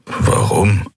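puzzled, voice barely there; honest confusion. A narrator's reading. Keru puzzled by her apology, breathy confused question. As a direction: ask it truly baffled. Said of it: "Ehrliche Verwirrung lag in seiner Stimme".

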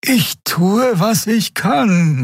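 high, drawn-out protest; slow, stressed high voice; strained answer. A narrator's reading. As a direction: answer under pressure, pitched high. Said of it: "murmelte sie".

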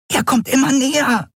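very high, tiny alarmed voice; alarmed warning. Acted. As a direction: warn urgently in a small, high-pitched voice. Lookout warns of nearing pursuer. (alarmierte, sehr hohe Stimme)

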